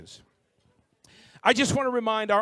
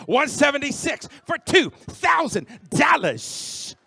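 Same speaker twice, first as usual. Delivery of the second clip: excited shout; 6 semitones higher, slower than usual. Real, not acted. Bidding climbs, auctioneer shouts.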